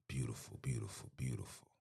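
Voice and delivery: soft, low whisper of beautiful. Real, not acted. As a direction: breathe the word out, barely voiced. Host reacting to comments.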